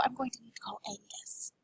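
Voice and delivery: quiet unvoiced whisper. Madam whispering that she must call Angus. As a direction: lean in and whisper it.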